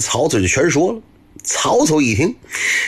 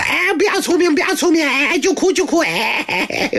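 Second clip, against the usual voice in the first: put-on much higher, whining little-kid voice; whiny child. Crying child whines back at coaxing mother, high and whiny. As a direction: sulk back like a crying toddler.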